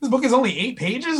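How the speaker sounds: high-pitched count crumbling into astonishment; imitating a flabbergasted friend; dawning disbelief. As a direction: count aloud, then realize your mistake. Reader counts pages, discovers truth.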